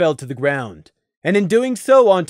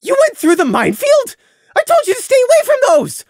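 high, furious yelling; angry shout; 8 dB louder than usual. Acted. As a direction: scream it out in outrage. Prey furious at Crimson.

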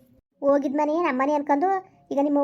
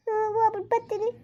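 bride's farewell voice; 8 semitones higher, 3 dB quieter than usual. Acted. Daughter takes leave of mother.

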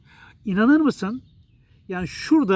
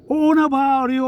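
mock shouting; 7 semitones higher, 5 dB louder than usual. Imitating series characters yelling.

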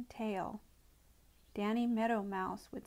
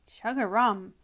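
louder, breathy croaked exclamation; frog croak; 7 dB louder than usual. A narrator's reading. Grandfather Frog clearing his throat before the tale, croaked.

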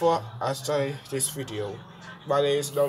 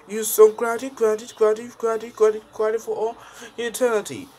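much higher, louder gushing thanks; high squeaky repeated thanks; high excited voice; 12 semitones higher, 7 dB louder, faster than usual. Acted. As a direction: gush as a squeaky puppet. High voice thanks Doc Bowser.

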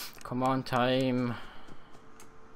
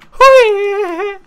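startled scream; 18 dB louder than usual. Real, not acted. Sudden jump-scare outburst.

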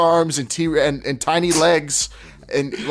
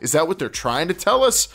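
usual speaking voice

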